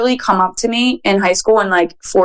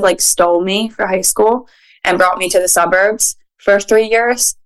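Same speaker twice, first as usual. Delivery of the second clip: usual speaking voice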